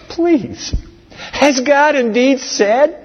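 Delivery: a hint of a scoffing tempter; mocking serpent. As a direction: play the sly serpent mocking her. Preacher voicing the serpent.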